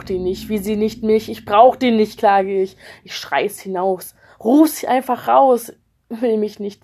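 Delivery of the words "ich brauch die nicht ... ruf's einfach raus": loud angry outburst; wütender Ausbruch. Acted. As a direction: shout your defiance out. Screaming it out in rage. (wütend herausschreien)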